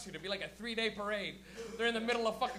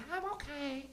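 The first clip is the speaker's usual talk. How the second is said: higher, softer put-on puppet voice; lady answering herself; 5 semitones higher, 5 dB quieter, slower than usual. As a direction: answer in a small, high second voice. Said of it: "a lady talking to herself".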